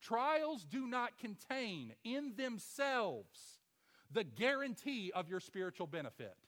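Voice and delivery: each word slow and weighted; slow, stressed teaching line; very slow emphasis. Shown as a demonstration. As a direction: drive the point home word by word. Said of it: "listen to this 'cause this is very important".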